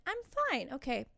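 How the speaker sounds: high, squeaky insistence of being okay; strained mock composure. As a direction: squeak it out, pretending to hold it together. Pretends composure while getting emotional.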